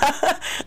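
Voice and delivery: a short high laugh; real laugh, high; high giggle. Real, not acted. Laughing at story.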